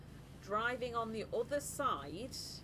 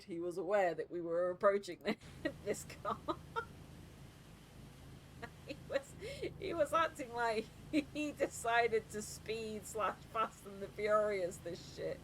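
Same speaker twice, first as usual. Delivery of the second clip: usual speaking voice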